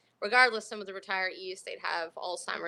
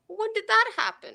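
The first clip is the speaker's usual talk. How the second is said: put-on surprised old lady; 13 semitones higher, 4 dB louder than usual. Resident surprised she's diabetic.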